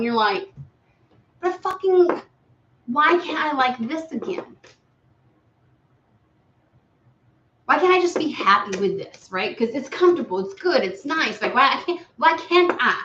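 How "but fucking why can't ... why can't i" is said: pitched-up mock frustration; frustrated whine. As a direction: whine like you're fed up and stuck. Client's whining inner voice again.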